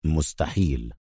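low, quiet, firm refusal. A narrator's reading. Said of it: "قال أدهم في حزم".

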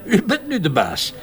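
amused, laughing through the words. Acted. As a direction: chuckle it out, lightly amused. Commissaris amused by her promotion.

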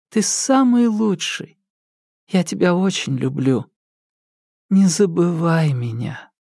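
a hint of a loving mother saying goodbye; lightly voiced mother, holding back tears; tender mother. Mother's farewell to her son.

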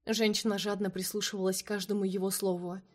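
plain reading voice

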